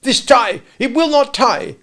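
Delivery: loud, high exasperated shouting; furious. Acted. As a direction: rage at the stubborn tie. Father raging at his tie.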